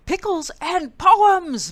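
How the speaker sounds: loud, high, slow roar; bellow. A narrator's reading. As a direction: boom it across the room. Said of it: "bellowed the Bear".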